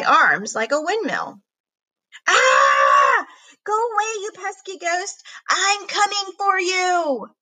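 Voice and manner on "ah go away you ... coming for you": high charging yell; battle cry. Acted. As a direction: scream while charging the door. Charging at the ghost yelling.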